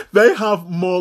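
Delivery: breaks into a high laugh on the words. Real, not acted. Vlogger cracking up at his own point, laughing. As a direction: laugh through it, voice jumping up high.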